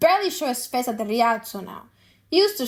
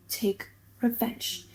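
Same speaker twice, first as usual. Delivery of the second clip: softer, slow, dark threat; menacing hush; 8 dB quieter, slower than usual. Acted. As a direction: let the threat sink in quietly. Shylock's revenge speech climax.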